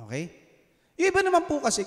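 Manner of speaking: normal talking voice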